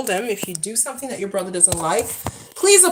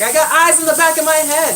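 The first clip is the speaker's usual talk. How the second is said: imitating mom; 6 semitones higher, 7 dB louder than usual. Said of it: "She'd be like".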